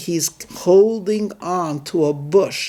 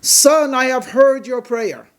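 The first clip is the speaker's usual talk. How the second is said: God's voice, raised and high; booming voice of God; 8 semitones higher than usual. Acted. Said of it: "a booming voice coming from every direction".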